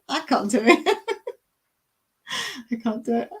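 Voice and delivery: usual speaking voice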